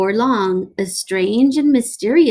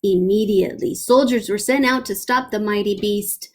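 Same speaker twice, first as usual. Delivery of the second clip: plain reading voice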